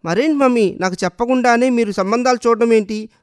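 irritated daughter, voice lifted; a bit irritated. A narrator's reading. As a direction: push back, mildly annoyed. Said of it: "కొంచెం చిరాగ్గానే అంది".

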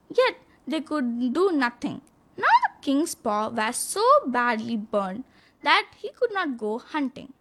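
plain reading voice

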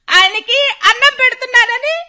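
normal talking voice

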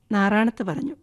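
plain reading voice

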